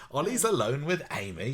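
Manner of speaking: a mock British accent, high and alarmed. Mother rushing to stop the couple, in a British accent. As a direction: redo the line in her best British accent. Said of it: "her best British accent".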